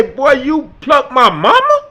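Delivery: put-on loud, slow, outraged yell at the boy; shocked shout. Father yelling at his son over dinner, outraged. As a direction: bellow it in disbelief.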